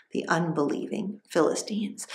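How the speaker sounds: ordinary delivery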